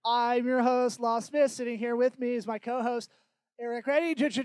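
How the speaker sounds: booming radio announcer intro. Pretend. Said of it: "I didn't do the radio voice".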